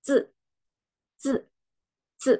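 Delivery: "Zi" cut short as if ending in K; snapped fourth tone. Shown as a demonstration. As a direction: jerk "Zi" down abruptly. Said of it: "seolah-olah ada huruf K di ujung karena kita sentak".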